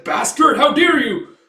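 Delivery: high, loud acted outrage; outraged shout. Father slashes at the young man, outraged shout. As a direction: hurl it furiously at an enemy.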